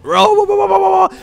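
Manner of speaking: warbling squeal, very high and loud; a wobbling creature howl; imagined spirit noise. Pretend. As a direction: yodel it out like a silly creature. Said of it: "That's the noise I like to imagine it makes".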